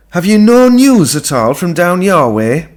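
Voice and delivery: high, loud mother's voice; Ma's voice. A narrator's reading. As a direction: raise her pitch and volume. Ma changes the subject.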